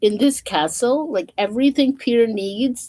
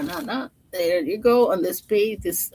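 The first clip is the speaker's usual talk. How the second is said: ordinary delivery, nothing marked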